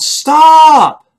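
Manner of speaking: sudden high, loud shout of alarm; urgent yell. Retold or imitated. Passenger yells at the driver to stop, urgent shout. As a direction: bellow it to halt the car. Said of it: "and I yelled".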